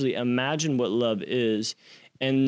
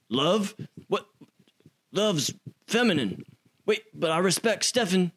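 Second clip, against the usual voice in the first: higher, fast, puzzled inner voice of a hard man; confused tough guy; 8 semitones higher than usual. Retold or imitated. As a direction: rattle through it like a soldier thrown by the word love. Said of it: "it was a combination of being like".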